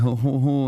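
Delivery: put-on gloating "ho ho ho"; imitated smug laugh; mock chuckle. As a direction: fake slow chortle in a mocking voice. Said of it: "You're like".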